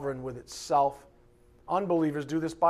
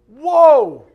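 very loud, high exclaimed whoa; huge shocked whoa; 8 semitones higher, 16 dB louder than usual. Shown as a demonstration. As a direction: blast the word out in alarm. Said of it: "Not like, "Whoa, Nelly the horse".

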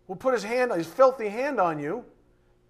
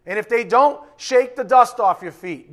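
forceful preaching; 4 semitones higher, 9 dB louder than usual. Real, not acted. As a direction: declare it with full force. Preacher proclaims scripture loudly.

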